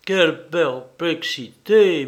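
ordinary delivery, nothing marked

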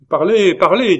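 high, loud urging call; crowd calling out. A narrator's reading. Crowd urges the orator to speak, calling out loudly. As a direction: call out eagerly as several voices. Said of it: "dirent plusieurs voix".

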